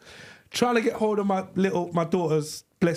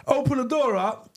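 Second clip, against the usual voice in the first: ordinary talk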